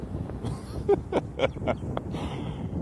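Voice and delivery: a quick airy laugh at the story; short breathy laugh. Real, not acted. Laughing at nephew jumped by girls. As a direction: let a soft laugh slip out.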